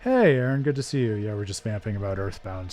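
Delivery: ordinary delivery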